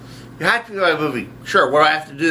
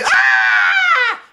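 very high piercing scream; sudden high-pitched death scream. Acted. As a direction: shriek at the top of the range. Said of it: "he let us scream".

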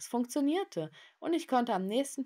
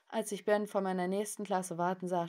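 usual speaking voice

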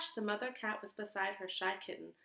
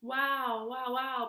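puppy barking; 3 semitones higher, 4 dB louder, much slower than usual. Acted. Mischievous puppy barks on the way home, yappy barks. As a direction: bark like a happy pup. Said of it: "barked the mischievous puppy".

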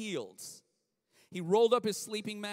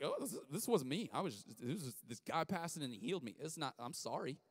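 sheepish excuse; 4 semitones lower, 6 dB quieter than usual. Retold or imitated. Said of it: "So all of a sudden he's like".

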